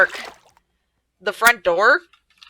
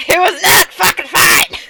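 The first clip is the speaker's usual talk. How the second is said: "not fucking fine" yelled higher, louder and slower; real shout; 10 semitones higher, 7 dB louder than usual. Real, not acted. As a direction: belt out the punchline, exasperated.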